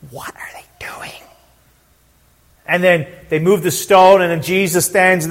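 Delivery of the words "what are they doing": hushed, voiceless aside to a friend; secretive whispered question; stage whisper. Acted. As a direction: whisper it like a baffled onlooker.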